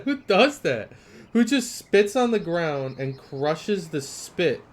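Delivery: high, loud laughing question; laughing disbelief. Real, not acted. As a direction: laugh at the absurdity. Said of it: "that's weird".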